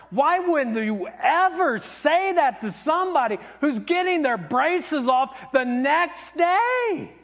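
high, drawn-out exasperated scolding; going off on someone. Retold or imitated. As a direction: scold Mom in disbelief, leaning hard on the words. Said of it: "I just kind of went off on you".

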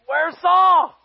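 high, loud call for someone missing; puzzled calling out. Acted. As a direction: call out across a crowd, acted bewilderment. Crowd searching for missing king.

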